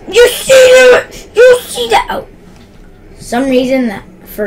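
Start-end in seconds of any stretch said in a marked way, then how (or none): 0.1-2.3 very high, loud excited yelling; excited shout. Real, not acted. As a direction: yell it out, thrilled by the play. Reacting to a play.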